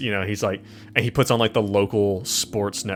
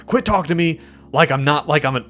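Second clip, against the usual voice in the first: raised, exasperated protest; indignant; 4 semitones higher, 5 dB louder than usual. Acted. Restrained patient to his orderlies, indignant. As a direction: push back loudly at being talked down to.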